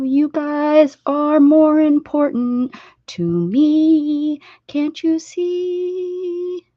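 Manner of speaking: slow, high, syrupy sweetness; hammed-up tender declaration; mock sappy. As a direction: overdo the sentiment jokingly.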